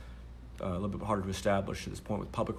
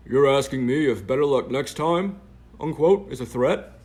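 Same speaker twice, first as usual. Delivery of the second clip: own voice, mocked; 5 semitones higher, 11 dB louder, slower than usual. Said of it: "doing her voice and my voice".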